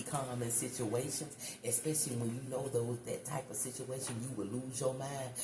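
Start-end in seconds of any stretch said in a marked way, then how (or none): none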